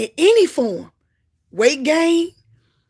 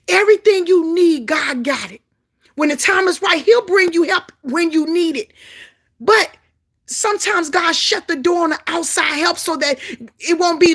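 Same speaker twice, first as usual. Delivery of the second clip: raised, fervent preaching; preaching at full force; 6 semitones higher, 5 dB louder than usual. Real, not acted. Preacher urging the congregation, fervent and loud. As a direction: drive it home to the church.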